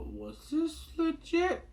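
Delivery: high, slow doubting question; a viewer doubting the plot's realism; sceptical. Real, not acted. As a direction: stretch it out in disbelief. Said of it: "there was a part I'm like".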